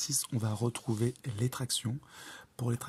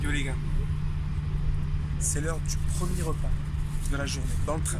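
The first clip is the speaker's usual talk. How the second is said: loud hype; 3 semitones higher, 7 dB louder, faster than usual. Real, not acted. Loud toast on the train. (ton enjoué et fort)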